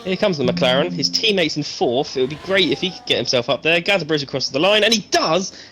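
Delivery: building excited play-by-play; race commentary. Acted. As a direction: call the lap as it happens. Live commentary on a lap.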